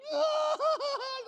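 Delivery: loud, high-pitched imitated wail; mock bawling. Son imitating his mom meeting the pope, loud mock bawling. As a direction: burst into exaggerated sobbing. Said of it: "like, just starts bawling".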